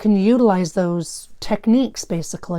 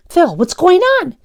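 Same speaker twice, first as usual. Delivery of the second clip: put-on high, loud frightened kid; scared yell; 10 semitones higher, 7 dB louder than usual. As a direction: yell in alarm at your friend. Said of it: "Daniel yelled".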